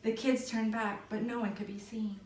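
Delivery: ordinary delivery, nothing marked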